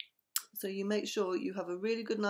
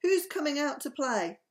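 higher-pitched storybook invitation; excited cry; 5 semitones higher, 4 dB louder than usual. A narrator's reading. Buster invites friends out to play, calling out. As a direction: call out lightly to the group. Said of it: "he cried".

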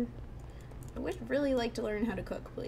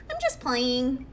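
put-on little kid voice; 2 semitones higher, 4 dB louder, slower than usual. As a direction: play a cheeky ghost kid pretending to be normal. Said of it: "I'll just give it a little kid voice".

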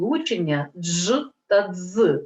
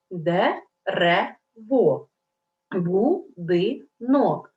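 syllable by syllable; much slower than usual. Shown as a demonstration. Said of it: "Трискладові".

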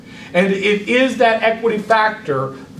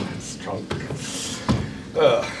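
muttered aside; 12 semitones lower, 10 dB quieter than usual. Real, not acted. Aside about the vodka.

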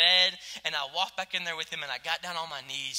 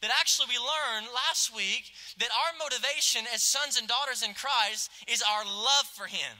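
usual speaking voice

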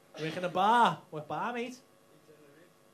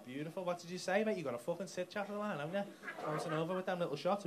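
ordinary talk

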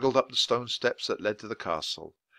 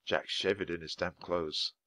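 ordinary delivery

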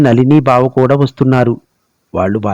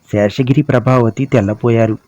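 plain reading voice

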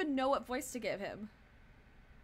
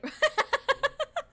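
loud, high-pitched laughing; real burst of laughter; 9 dB louder than usual. Real, not acted. Streamer cracking up at the game, loud high laugh.

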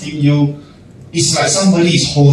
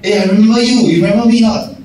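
higher, cheery pretend reunion with a laugh; mock friendly greeting; 7 semitones higher, faster than usual. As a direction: play the overeager stranger who recognizes you. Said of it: "they say".

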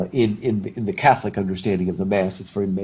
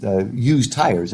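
ordinary talk, nothing marked

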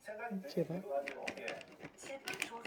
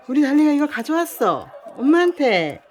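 much louder, high praise; loud excited praise; 5 semitones higher, 8 dB louder than usual. Real, not acted. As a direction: cheer the dog on loudly. Loudly praising dog.

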